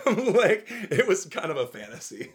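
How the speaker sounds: high loud laughing words; giddy laughter. Real, not acted. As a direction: laugh through it, delighted. Laughing at herself.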